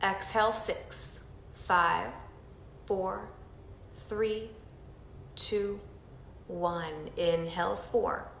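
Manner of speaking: soft, slow counting through each breath; guided breathing count. Acted. Instructor leading the group through breathing, soft slow count. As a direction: guide them through the breath, gently and slowly.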